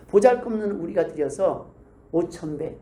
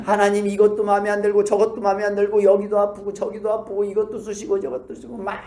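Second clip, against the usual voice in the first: ordinary talk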